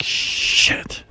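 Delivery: unvoiced whispered curse. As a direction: breathe the word out with no voice. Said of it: "I was like".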